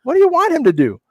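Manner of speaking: high incredulous rant, imitating the inner reaction; exasperated rant. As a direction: vent in disbelief, voice climbing high. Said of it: "And in my head, I'm like".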